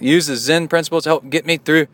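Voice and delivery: loud, high mock Scottish brogue; Scottish accent. Host finishing listener's email, loud put-on Scottish accent. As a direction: drag out the last words in hammed-up Scots. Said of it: "Should I read it with my Scottish accent?".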